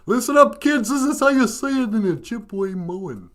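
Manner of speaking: goofy dog, high and drawn out; high-pitched goofy dog character; Benjamin. Pretend. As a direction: teach the words as a goofy talking dog. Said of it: "you know, goofy of the two".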